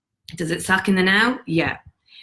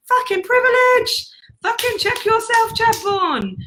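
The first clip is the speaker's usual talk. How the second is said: exasperated shout; 4 dB louder, slower than usual. Real, not acted. Scolding the self-pitying listener.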